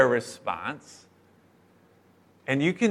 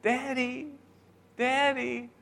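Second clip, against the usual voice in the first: high, much softer, sugary drawn-out Daddy; sweet little girl pleading; 10 semitones higher, 9 dB quieter, slower than usual. Retold or imitated. Daughters batting their eyes at dad, cutesy soft pleading. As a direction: melt him with a cutesy plea. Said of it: "batted their baby brown eyes at him".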